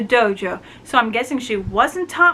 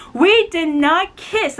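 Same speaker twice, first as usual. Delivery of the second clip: flustered denial; 7 semitones higher, 3 dB louder, slower than usual. Retold or imitated. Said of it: "Obsidian and Dong Tu".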